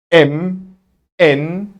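ordinary talk, nothing marked

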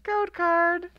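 high, loud wail of dismay; exasperated wail. Real, not acted. Pack opener wailing at yet another code card. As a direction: cry it out in fake despair.

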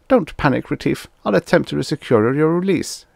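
a mild, voiced hiss over the shoulder; urgent reassurance thrown back quickly; hissed aside. A narrator's reading. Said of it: "Nitworth hissed over his shoulder".

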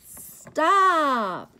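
high, loud call to halt; shouted stop. Acted. Character calls out to Mister Gilly, loud stop. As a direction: yell it to stop the truck.

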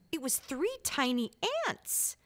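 ordinary delivery

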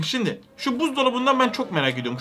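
ordinary talk, nothing marked

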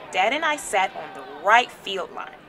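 usual speaking voice

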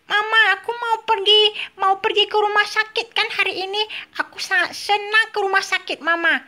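put-on high little-child voice, eager; a toddler chirping to his mama, pitched high; baby telling Mama he wants to go, high child voice; baby Tom Tom voice. Toy baby Tom Tom speaks.

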